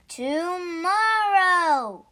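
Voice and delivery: a long, loud, high wail of Tomorrow; cried out. Acted. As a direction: stretch it out slowly and cry it out. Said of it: "cried Told".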